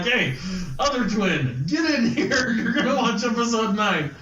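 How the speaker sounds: calling out. Real, not acted. Dad calls the other twin.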